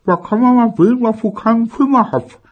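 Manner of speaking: high, loud, mumbled grumble; grumbling with ice in mouth. Acted. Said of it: "maulte seine Mutter".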